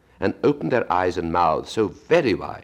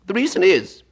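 high Gryphon voice; 7 dB louder than usual. Acted.

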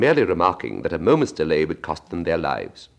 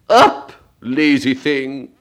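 higher, louder, very slow scolding Queen; Queen commanding; 7 semitones higher, 8 dB louder, much slower than usual. Acted. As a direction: rouse the sleeping beast with scorn. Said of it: "said the Queen".

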